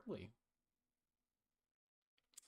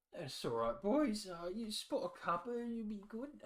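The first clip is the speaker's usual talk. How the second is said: put-on high, kindly old man voice; lightly voiced kind old man; friendly old man; 11 semitones higher, 4 dB louder than usual. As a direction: reassure the boys like a doddery host.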